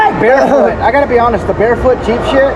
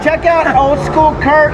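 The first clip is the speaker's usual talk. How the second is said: slow plug; 3 semitones higher, slower than usual. Shown as a demonstration. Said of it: "plugging his channel".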